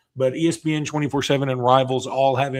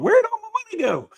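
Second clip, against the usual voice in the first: high squeaky panicked question; mock panic; faster than usual.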